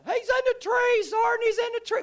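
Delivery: very high, loud frantic yell; high-pitched shouted alarm; panicked yelling. Retold or imitated. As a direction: yell a warning to your sergeant at the top of your voice. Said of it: "Floyd starts yelling".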